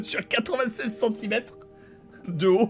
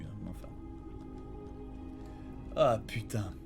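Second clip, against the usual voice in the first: much softer, lower grumble; muttering under breath; 13 semitones lower, 16 dB quieter than usual. Real, not acted. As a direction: mutter it to yourself.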